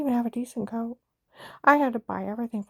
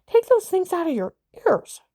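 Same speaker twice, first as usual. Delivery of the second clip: firm insistent order; 9 semitones higher, 6 dB louder than usual. Retold or imitated. Repeating firm instruction.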